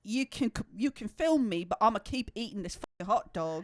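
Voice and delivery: mock British man defending his snack; imitated British geezer. Imitating an interviewed fan with a hot dog, mock British accent. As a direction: put on an older British bloke's accent. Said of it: "the guy just got done eating".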